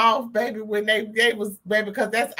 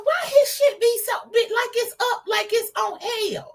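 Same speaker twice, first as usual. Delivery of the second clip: high-pitched incredulous question; mock-bewildered, very high voice; baffled questioning; 12 semitones higher than usual. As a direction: squeal the question, held high throughout. Acting out own baffled question.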